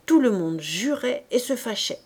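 ordinary delivery

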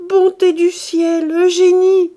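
high, loud, slow cry of joy; mother calling her daughter, shouting with joy; joyful cry. Acted. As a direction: call out to her daughter, overjoyed. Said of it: "cria la mère en rougissant de joie".

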